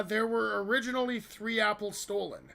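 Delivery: ordinary talk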